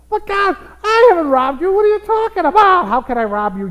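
loud, high mock outrage; hammed-up, high-pitched objection; indignant protest. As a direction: play a believer protesting innocence to God. Acts out a believer protesting to God.

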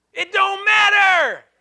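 loud, slow, high rebuke; booming retort from the pulpit; preacher's shout. Real, not acted. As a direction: slam the excuse down hard. Pastor rebuts congregant's excuse.

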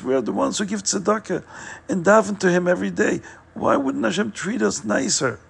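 a wife begging God through her tears, voice pushed up high; crying, pleading wife. Acted. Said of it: "And she cried and cried".

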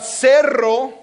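cerro said slowly with a rolled rr; trilled rr. Shown as a demonstration. Pastor contrasting cerro and cero. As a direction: roll the rr in cerro. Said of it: "Cerro means hill".

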